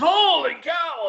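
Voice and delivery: high, loud, slow shocked gasp of words; shocked outburst. Retold or imitated. Husband shocked at the price, high loud slow exclamation. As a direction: let it burst out at the price. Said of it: "he starts freaking out".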